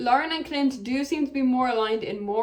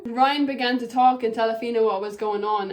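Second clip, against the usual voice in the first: usual speaking voice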